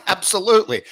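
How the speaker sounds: mock keen agreement; eager absolutely.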